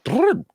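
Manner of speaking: high lip-trill brrr for the flutter; a heart skipping into fibrillation. Shown as a demonstration. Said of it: "My heart was like".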